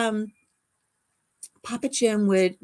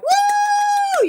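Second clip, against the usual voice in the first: very high loud woo; fan shout; 8 dB louder than usual. Retold or imitated. Teen fan shrieking at the [name] concert, loud high woo. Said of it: "I was like".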